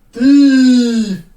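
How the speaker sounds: booming golem voice shouting. Acted. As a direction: roar it like a stone golem.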